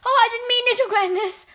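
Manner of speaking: very high, loud plea; frightened witch crying out. A narrator's reading. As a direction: squeal an anxious apology. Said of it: "the witch cried out".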